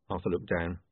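ordinary delivery

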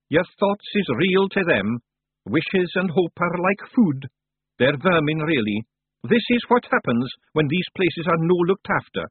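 high Scots voice explaining a danger; earnest little Scottish guide; Rob Anybody, knowing Scot. Acted.

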